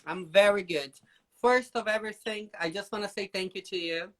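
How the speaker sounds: usual speaking voice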